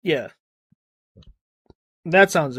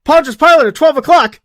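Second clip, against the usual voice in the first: louder, higher mock alert call; mock urgent warning; 8 semitones higher, 7 dB louder than usual. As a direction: call it out like a lookout, half-hearted. Bounty hunter warns, retold sketch.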